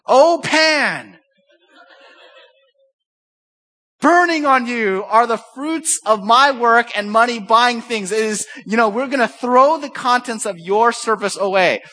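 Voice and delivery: high, loud, slow mock oracle voice; hammed-up prophetic rebuke of the pan; mock-solemn cry at the cooking pan; mock prophet to wife. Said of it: "me going to Karen and saying".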